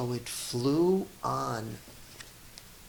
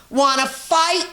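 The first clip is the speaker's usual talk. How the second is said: high, loud challenge from a tiny bug; grouchy ladybug; 11 semitones higher, 10 dB louder than usual. A narrator's reading. Ladybug squaring up to the rhinoceros, high and loud. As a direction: squeak it out like a picking-a-fight bug. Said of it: "said the grouchy ladybug".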